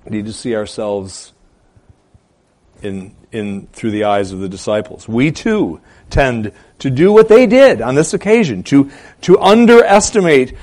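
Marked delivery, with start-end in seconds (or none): 5.1-10.5 high, loud sermon delivery; impassioned, raised pulpit voice; forceful preaching. Real, not acted. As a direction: preach it hard, hitting each item of the list.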